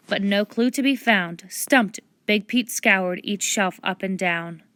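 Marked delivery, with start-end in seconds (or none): none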